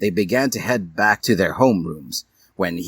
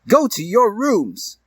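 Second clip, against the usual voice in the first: stern teacher; 13 semitones higher, slower than usual. Acted. Teacher orders.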